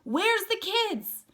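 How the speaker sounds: high, loud and slow over the roar; shouted question. Acted. Johnny shouting to Ponyboy over the fire, loud high question. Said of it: "Johnny shouted the next question".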